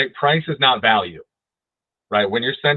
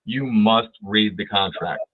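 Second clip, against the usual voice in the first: "You must read the contract" slow, with "must" leaned on and falling; slow emphasis; slower than usual. Shown as a demonstration. Instructor insisting to agents, slow and emphatic. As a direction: hammer the "must" slowly. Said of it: "I can't emphasize this enough".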